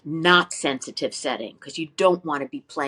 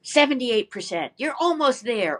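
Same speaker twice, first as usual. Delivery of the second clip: higher-pitched mock app voice announcing a score; imitating the app; 8 semitones higher than usual. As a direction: play the cheery tuning app grading you. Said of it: "and it tells you".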